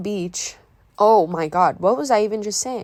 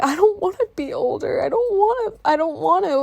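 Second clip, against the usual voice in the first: put-on high-pitched whiny kid plea; imitating a tearful teenage protest; mock sobbing; 9 semitones higher than usual. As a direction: wail it like an upset child, hammed-up. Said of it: "like, sobbing at night".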